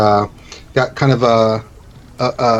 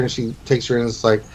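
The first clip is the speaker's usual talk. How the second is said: ordinary delivery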